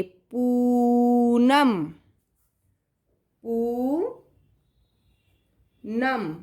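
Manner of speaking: very slow drawn-out Poonam; dictation word. Shown as a demonstration. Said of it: "अपनी ma'am का नाम लिखेंगे".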